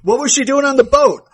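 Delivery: loud, high bellowed question; bellowing. Retold or imitated. Friend repeating Roman's blurt at full bellow. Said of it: "Roman practically bellows".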